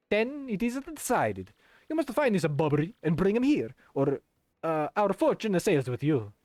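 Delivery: put-on high, grand Italian accent. Flavio sends the captain to find Bobbery, Italian voice. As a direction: send them off in an Italian accent. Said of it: "the kind of Italian voice".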